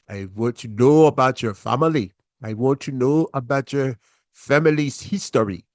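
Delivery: a hint of a stern African father; measured, stern African accent questioning the boyfriend; father grilling daughter's boyfriend, lightly imitated African accent. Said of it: "my best version of talking like her father".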